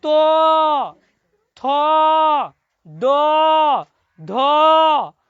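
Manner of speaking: तो, थो, दो, धो called out loud from the lips; loud letter demo. Shown as a demonstration. Teacher to class, loudly sounding lip letters. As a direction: belt out each lip letter. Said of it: "ओठ से होता है".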